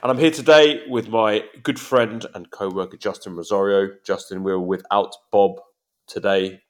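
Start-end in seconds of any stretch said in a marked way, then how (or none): none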